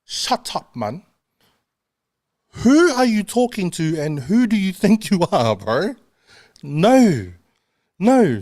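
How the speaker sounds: high mock London roadman bluster; London roadman retort. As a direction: snap back in a put-on London accent. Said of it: "And there, there I am".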